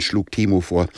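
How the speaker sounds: ordinary delivery, nothing marked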